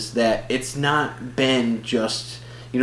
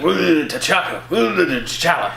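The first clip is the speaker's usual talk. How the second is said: higher, slow drawn-out comic impression; Bernie Mac; 5 semitones higher, much slower than usual. Fan imagining him in the movie, comic impression. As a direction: stretch each woo into a grumble. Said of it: "I would have loved Bernie Mac".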